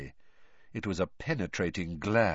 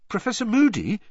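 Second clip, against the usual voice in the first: high, louder appeal; Harry, pressing; 12 semitones higher, 6 dB louder than usual. A narrator's reading. Harry burning to know more, pressing Moody. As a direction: call for the teacher's attention in a higher voice.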